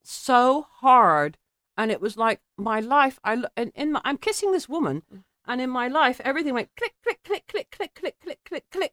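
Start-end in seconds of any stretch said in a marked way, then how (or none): 0.0-1.4 very slow, word by word; heavy, drawn-out emphasis; emphatic. Real, not acted. Dramatic telling of falling in love. 6.8-8.9 string of high, soft clicks; high, ticking, quieter repetition; rapid realisation. Retold or imitated. Acting out things falling into place.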